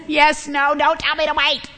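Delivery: high-pitched mock childish protest; hammed-up whiny impatience; impatient tantrum. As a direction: protest like a child told to wait. Acting out impatience with waiting.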